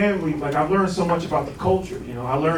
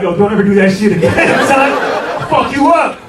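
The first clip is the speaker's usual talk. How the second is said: angry scolding; 4 semitones higher, 8 dB louder than usual. Retold or imitated. Sister scolds him at party.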